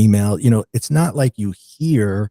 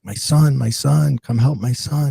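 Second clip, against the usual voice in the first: a touch of a panicked father, pitched higher; higher, half-voiced cry for help; retold distraught plea; 5 semitones higher than usual. Father begging paramedics over his son, mild retold distress. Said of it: "he's distraught".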